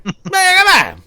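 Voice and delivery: loud, high Cajun gibberish; mock Cajun. Cajun drinker answering a whiskey offer, loud mock-Cajun gabble. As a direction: holler garbled Cajun like a drunk local. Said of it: "this dude talking Cajun".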